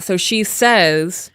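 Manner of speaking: ordinary delivery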